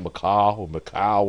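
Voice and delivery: "Macau or Macau" said slowly, the name pronounced two different ways; two pronunciations of Macau. Shown as a demonstration. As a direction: try out "Macau" both ways, unsure which is right. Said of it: "I don't know if I'm saying that".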